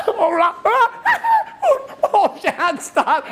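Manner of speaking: very high, helpless laugh-cry. Retold or imitated. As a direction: act out laughing through painful massage. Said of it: "I laugh and then I'm crying".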